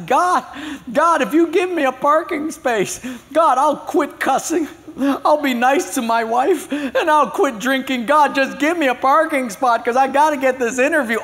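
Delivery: high, loud, frantic begging to God; desperate pleading. Acted. Late driver begging God for a parking space, frantic loud plea.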